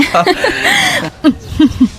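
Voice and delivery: high, shaky nervous giggle; nervous laugh. Acted. Tenant hides her fear of heights from the landlady, nervous laughing.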